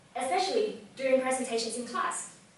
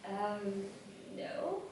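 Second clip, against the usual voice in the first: hesitant; 4 semitones lower, 11 dB quieter than usual. Retold or imitated. Sheepish answer to question, retold.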